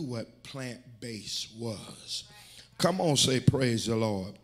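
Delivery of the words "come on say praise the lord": loud call for a congregational response; rally the church to answer; big, punched church call-out; rousing call. Real, not acted.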